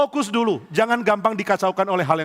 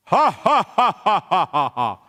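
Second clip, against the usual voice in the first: slow, booming mock giant laughter; much slower than usual. Goliath laughs at David, slow booming giant laugh. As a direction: laugh like a huge mocking giant. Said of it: "dia keluarkan ketawa raksasa tadi".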